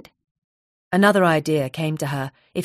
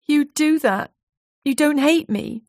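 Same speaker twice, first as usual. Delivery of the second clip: a touch of tearful disbelief, higher; 10 semitones higher than usual. Acted. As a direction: voice lifting through held-back tears. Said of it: "Jade's eyes filled with tears".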